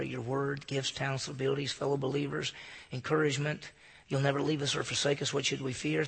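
soft, reverent prayer voice; hushed pastor's prayer; closing prayer. Acted. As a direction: pray gently over the room.

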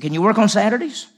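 eager boss who wants to play softball. Retold or imitated. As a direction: ask it bright and pushy.

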